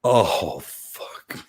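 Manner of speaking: low, falling to a near whisper; stunned dismay. Retold or imitated. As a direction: recreate a sinking reaction under your breath. Recalls being stunned by the rebuke.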